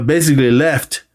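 ordinary talk, nothing marked

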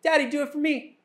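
put-on high, bright child pleading; a little girl tugging at her dad, asking for a turn; little daughter's voice. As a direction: play the small girl begging for a spin. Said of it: "the other one comes up and goes".

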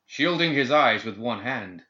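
plain reading voice

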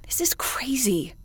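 stunned, voiceless disbelief; shocked whisper. Acted. Commander to the ship's AI, whispered shock. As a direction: whisper it as the truth sinks in. Commander realizes crew is lost.

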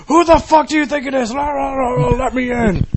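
put-on high, slurred drunk shouting; mock drunk rant trailing into babble; belligerent drunk. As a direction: holler through the door, then garble it. Acting the drunk at door.

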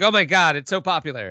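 high, loud gushing shopkeeper; excited store clerk. Retold or imitated. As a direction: gush like an amazed clerk. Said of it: "And they're like".

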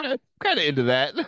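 high, fast, embarrassed giggle; giggly admission. Real, not acted. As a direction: admit it through a laugh.

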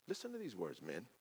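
soft, low call to listen; hushed call. Acted. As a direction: call them in quietly.

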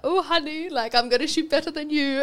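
playful high-pitched teasing tone. Retold or imitated. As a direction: tease your partner in a lifted voice. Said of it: "and they're like".

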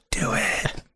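urging whisper. Acted. Player eggs Jacob on from behind, whispered. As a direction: egg him on in a whisper. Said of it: "and whisper in his ear".

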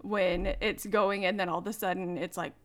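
normal talking voice

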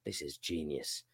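a hint of a low, soft self-satisfied booker; smug booker. Host mocking a wrestling booker, lightly voiced smug muttering. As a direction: murmur it like a booker pleased with a bad idea. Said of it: "the booker is like".

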